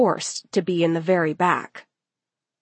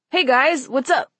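higher, chirpy hello; cheery greeting; 7 semitones higher than usual. A narrator's reading. Boy popping up out of nowhere, cheery. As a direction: pop in with an upbeat greeting. Leo pops up greeting the group.

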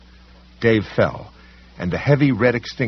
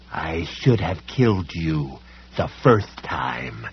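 slow menacing villain; 4 semitones lower, slower than usual. Acted.

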